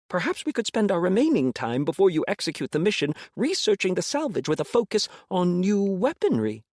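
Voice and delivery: high eager little alien; squeaky hopeful alien creature; Zool's voice. Acted. Zool the alien pleads.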